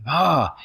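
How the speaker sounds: short high shriek; mock shriek. Retelling his scream on the mountain, a high mock yell. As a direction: let out a quick high yell. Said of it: "me yelling at that thing".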